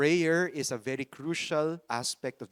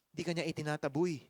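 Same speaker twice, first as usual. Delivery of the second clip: ordinary talk, nothing marked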